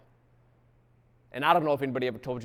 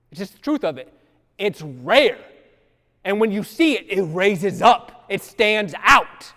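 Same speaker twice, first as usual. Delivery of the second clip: loud, rising sermon delivery; loud gospel storytelling; full-force preaching; 6 semitones higher, 13 dB louder than usual. Acted. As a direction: preach it out to the pews. Preaching crescendo.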